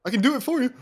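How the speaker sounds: loud, very high hammed-up offer; high mock voice. Offering to rage for a friend, high mock voice. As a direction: pitch it way up, pretend-helpful.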